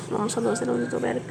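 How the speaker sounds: soft, level murmur; murmured blessing. Real, not acted. Teacher pausing mid-lesson to bless the Prophet, murmured softly. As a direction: say the prayer under your breath.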